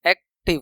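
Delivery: Active in two beats, एक and टिव; broken into syllables. Shown as a demonstration. Teacher showing the sound breaking, active split. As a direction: pause in the middle of active. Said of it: "कहीं ना कहीं एक sound टूट रहा है".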